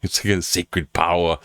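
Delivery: ordinary talk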